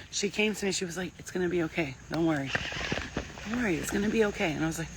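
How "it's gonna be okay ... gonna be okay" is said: reassuring nurse. Retold or imitated. Retold nurse calming her.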